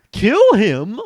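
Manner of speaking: high, loud shocked gasp. Acted. Hero shocked at the order, high gasp. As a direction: recoil at the idea. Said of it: "I gasped".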